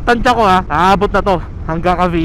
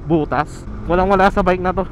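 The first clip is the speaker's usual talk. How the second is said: ordinary talk, nothing marked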